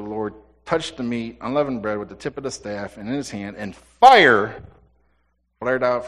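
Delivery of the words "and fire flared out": sudden loud burst on fire; dramatic fire. Acted. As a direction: fire shouted out.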